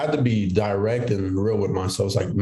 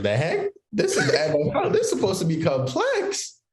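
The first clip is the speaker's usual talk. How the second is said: much higher, laughing disbelief; incredulous disbelief; 12 semitones higher than usual. Retold or imitated. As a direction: react like the playbook is a joke. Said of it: "I was like".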